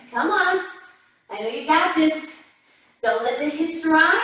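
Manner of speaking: ordinary talk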